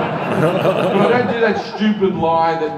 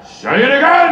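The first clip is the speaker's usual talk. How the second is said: higher, louder demanding shout; hammed-up bark, higher and louder; mock shout; 9 semitones higher, 8 dB louder than usual. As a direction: yell it like an old director pushing you. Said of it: "And he went".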